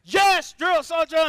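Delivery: high, loud recruit shout; barked military recruit reply. Acted. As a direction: shout back at the sergeant. Recruit answering sergeant.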